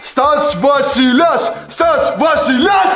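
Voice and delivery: slow, drawn-out announcement of a title; grand self-proclamation. Acted. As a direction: proclaim yourself king, stretching the words out. Boss proclaims himself king.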